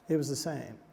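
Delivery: normal talking voice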